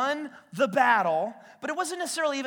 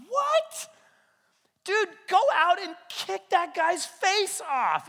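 leaping high on "What?" then a loud, slow dare; a cocky freshman egging them on; squeaky high shock, then a loud slow challenge; incredulous brash kid; 9 semitones higher, 5 dB louder, slower than usual. Retold or imitated. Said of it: "he's like".

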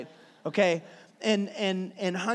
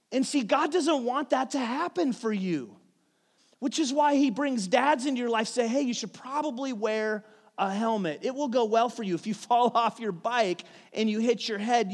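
raised, higher preaching voice; preaching build; 4 semitones higher, 3 dB louder than usual. Acted. Pastor urging the congregation, higher and louder preaching. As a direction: lift it up and preach it out.

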